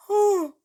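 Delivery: big sleepy yawn into oh; yawning sigh. Real, not acted. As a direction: yawn out a tired oh. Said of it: "Pardon me for the yawn".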